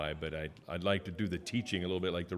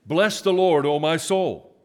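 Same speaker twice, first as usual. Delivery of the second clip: much higher, loud proclaiming; the pastor asks the church for more emphasis, then proclaims it high and loud; 8 semitones higher, 10 dB louder than usual. Shown as a demonstration. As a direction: push the line out with more emphasis. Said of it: "give it just a little more, little more emphasis there".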